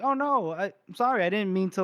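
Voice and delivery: high-pitched, flustered sorry; acted apology. Finree backtracking after crushing Gorst, high flustered apology.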